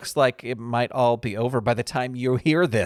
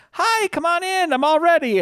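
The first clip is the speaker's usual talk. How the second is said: much higher, bright sing-song greeter voice; mock chirpy greeting; 4 dB louder than usual. Escrow officer greeting them after her cough, high mock cheer. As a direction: impersonate the office woman's overly cheery welcome.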